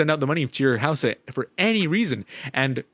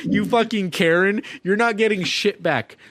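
slow, high-pitched gloating; cackling mockery; 6 semitones higher, slower than usual. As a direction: rub it in right after a cackle. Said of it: "I'm cackling at this point".

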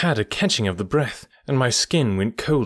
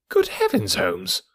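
shocked exclamation; slower than usual. Acted. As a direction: blurt it out, scandalised. Watson shocked at engagement news.

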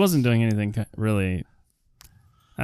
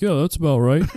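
higher, louder agreeing jock; jock sidekick; 5 semitones higher, 3 dB louder than usual. Retold or imitated. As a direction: nod along like a football buddy. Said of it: "the boys are like".

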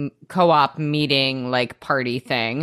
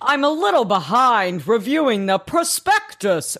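mocking excuse; 7 semitones higher than usual. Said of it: "his excuse was".

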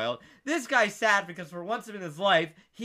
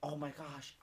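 small, soft, lower voice; retold meek apology; 4 semitones lower, 14 dB quieter than usual. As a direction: say it softly as the embarrassed kid. Kid apologises to stall man.

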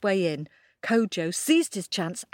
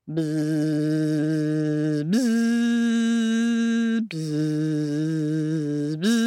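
drawn-out bee buzz; 2 semitones lower, much slower than usual. Shown as a demonstration. Inviting the kids to buzz along like bees. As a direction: buzz like a bee, long and steady. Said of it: "Can you buzz along with them?".

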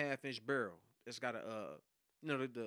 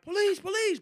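much higher and louder shout; retold police shout; 10 dB louder than usual. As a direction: announce yourselves at the door. Quotes police announcing raid.

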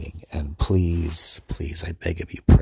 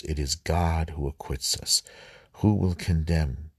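measured Bible reading, phrase by phrase; scripture reading; 3 semitones lower than usual. Acted. Man reading the Romans passage over again for listeners, deliberate scripture reading. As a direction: proclaim the verses deliberately, with pauses between phrases.

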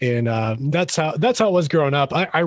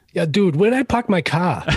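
Boston accent with dropped Rs, slightly higher; 4 semitones higher than usual. Shown as a demonstration. Guest showing off his hometown speech, Boston accent. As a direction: let the Boston sneak out, dropping the Rs. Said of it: "let that Boston sneak out for a second".

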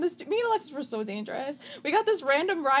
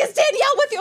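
much higher and louder, worried; high anxious friend; 12 semitones higher, 6 dB louder, faster than usual. Retold or imitated. Alexis calling, worried about Danielle. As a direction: ask it urgently. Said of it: "She's like".

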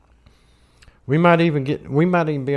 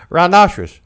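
"Rhinoceros" louder and higher, falling; insistent repeat; 4 semitones higher, 7 dB louder than usual. Retold or imitated. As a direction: announce "Rhinoceros" as if it were obvious. Retold namer insists on the name.

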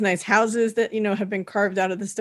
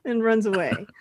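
ordinary talk

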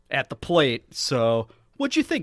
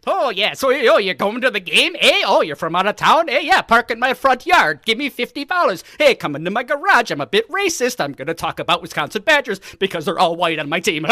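higher, louder hammed-up Wisconsin local; mock Wisconsin accent; 5 semitones higher, 3 dB louder than usual. Cohost mocking Wisconsin game-day locals in a hammed-up accent. Other host joins Wisconsin parody.

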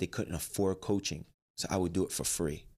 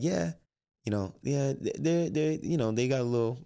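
high fake-casual excuse; 6 semitones higher than usual. Downplaying fight to the mother.

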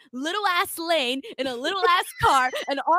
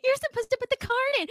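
mock desk clerk; 12 semitones higher, faster than usual. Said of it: "She was like".

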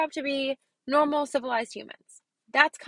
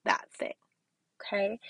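whispered aside; 2 semitones lower, 4 dB quieter than usual. Real, not acted. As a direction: drop to a whisper on that thing.

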